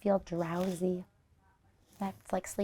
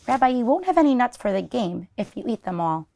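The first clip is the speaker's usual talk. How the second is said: ordinary delivery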